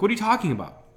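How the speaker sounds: high, disbelieving outburst; stunned at his dad's logic; incredulous. Retold or imitated. Son to dad, incredulous high outburst. Arguing with dad over gift.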